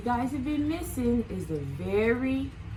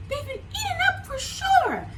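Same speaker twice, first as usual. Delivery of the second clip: much higher, wailing worried voice; worried Sheep. Acted. Sheep fearing friends were eaten, high worried wail. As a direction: fret like a frightened little sheep. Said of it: "Sheep was getting worried".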